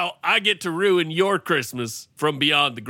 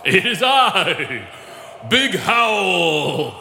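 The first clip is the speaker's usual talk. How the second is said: slow booming ghost voice; haunt him with a big spooky call; much slower than usual. Acted. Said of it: "is there a way for you to talk normal".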